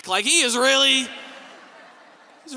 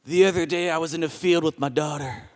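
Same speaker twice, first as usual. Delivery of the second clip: worship leader voice; 12 semitones lower, 4 dB quieter than usual. Retold or imitated. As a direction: drift into a deep worship leader voice. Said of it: "voice will get a bit more like this".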